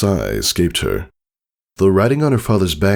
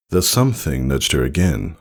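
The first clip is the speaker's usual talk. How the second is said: ordinary delivery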